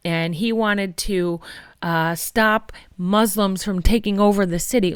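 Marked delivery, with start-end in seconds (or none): none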